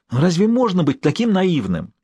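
high-pitched, exasperated disbelief; involuntary exclamation. A narrator's reading. Maxim hears his friend refuse all diplomacy, exclaiming involuntarily. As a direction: exclaim it before you can stop yourself. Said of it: "невольно воскликнул Максим".

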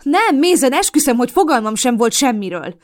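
loud, high pleading denial; frantic high denial; desperate denial. A narrator's reading. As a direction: swear it desperately. Desperate denial to angry partner.

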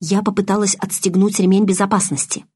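usual speaking voice

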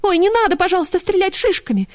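high, anxious little voice; high-pitched nervous pleading; frightened plea. A narrator's reading. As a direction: beg not to be shot. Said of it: "поджала уши и хвост".

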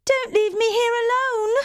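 put-on terrified child begging; frightened plea. Jane alone in the Red Room, desperate plea. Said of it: "I shouted".

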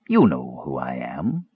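low, slow knowing voice; mysterious stranger. A narrator's reading. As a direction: draw it out low, lifting at the end. Enigmatic stranger answers a challenge.

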